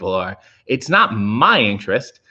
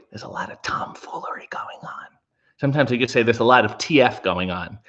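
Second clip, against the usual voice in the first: soft secretive whisper; 3 semitones lower, 3 dB quieter than usual. Pretend. Streamer keeping a rude word from watching kids, whispered. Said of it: "if I don't want the kids to hear".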